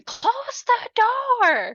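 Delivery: very slow, high mock scream; retold yell. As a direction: shriek it like a panicked victim. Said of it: "And she's yelling".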